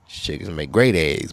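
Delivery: ordinary delivery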